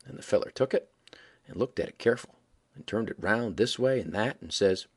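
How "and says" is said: ordinary delivery, nothing marked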